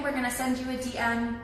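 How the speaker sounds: normal talking voice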